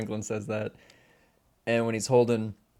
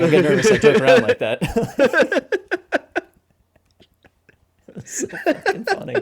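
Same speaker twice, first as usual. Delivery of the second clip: laughing through it; 13 semitones higher, 12 dB louder than usual. Retold or imitated. Retelling a nervous joke, laughing.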